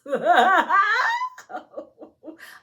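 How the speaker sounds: high, loud burst of laughter; excited laughing whoop. Real, not acted. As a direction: let out an overjoyed whooping laugh. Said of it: "I'm so excited".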